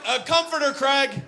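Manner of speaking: high-pitched mimicry of an answer; mocking impression of response. Comic mimicking audience member's comforter answer, mocking. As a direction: throw the answer back in a high mock voice. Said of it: "Did you see his response to that?".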